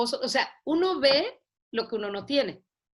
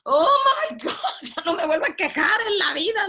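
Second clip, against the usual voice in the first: laughing disbelief; 9 semitones higher, 5 dB louder than usual. Retold or imitated. Reacting to mother's joke.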